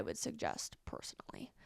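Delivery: confiding whisper. Real, not acted. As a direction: whisper it like a secret. Whispered confession of opinion.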